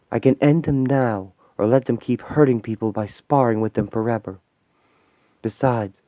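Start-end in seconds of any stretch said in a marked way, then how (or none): none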